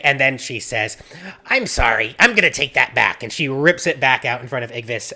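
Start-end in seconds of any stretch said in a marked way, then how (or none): 1.5-3.2 loud, flustered apology; panicky. Acted. Villager grabs the pheasant back from Igvis, panicky. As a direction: blurt it out in mild panic. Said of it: "kind of a panic".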